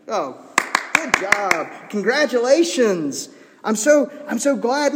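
loud, high mock delight; sarcastic congratulations. Paul congratulating boastful Corinthians, sarcastic. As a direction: gush with obviously fake praise. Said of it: "being wholly sarcastic".

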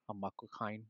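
very low, very soft Cockney impression; hushed, deadpan celebrity impersonation; Michael Caine. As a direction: murmur it deep, as a dramatic film cameo. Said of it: "there's Michael Caine, and he just goes".

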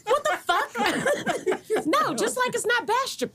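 high, laughing disbelief. Real, not acted. Friend hears a mispronounced town, laughing disbelief.

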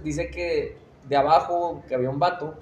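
ordinary talk

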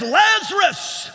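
long high shout of the name; loud call. Acted. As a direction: call him out of the grave. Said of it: "And he says".

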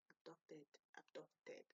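soft, tentative tries at "abducted"; practising under breath. Real, not acted. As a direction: test "abducted" quietly to yourself. Trying out a tricky word.

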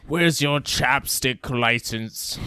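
loud, slow mock officer; mock police officer. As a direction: bark the question like a stuffy cop. Mocking English police.

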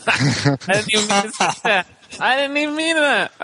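high-pitched helpless laughter through the words; giggling protest, words breaking into laughter; laughing hard. Real, not acted. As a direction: crack up at your own slip while talking. Cracks up at own slip.

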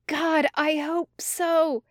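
high, slow, fervent wish; drawn-out, giddy longing; gleeful hoping. A narrator's reading. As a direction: squeeze it out high, overcome with glee. Gleeful Tim, overcome with laughter.

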